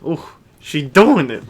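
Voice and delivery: loud excited cheer; excited ooh. Retold or imitated. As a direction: cheer her on loudly. Repeats louder, excited.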